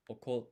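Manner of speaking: "okote" with a long silent gap before the stressed T; "okote" split by a beat, extra emphasis on the final syllable; double consonant. Shown as a demonstration. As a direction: hold the stop in "okote", then push out the "te". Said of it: "that extra emphasis".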